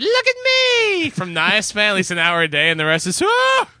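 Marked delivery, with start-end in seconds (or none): 0.0-1.1 spry taunting show-off. Acted. Taunting a friend on their deathbed, high gleeful mock boast. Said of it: "I'm still, like, spry and". 3.2-3.7 short, very high comic wail; mock high cry. Acting out the deathbed scene for laughs, high mock cry.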